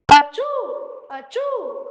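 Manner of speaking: big high sneezing fits; loud sneezes. Acted. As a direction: sneeze hard twice. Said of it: "he sneezes".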